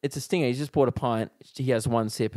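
ordinary talk

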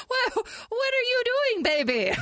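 high, laughing disbelief; cracking up mid-question. Real, not acted.

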